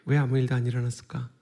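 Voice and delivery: normal talking voice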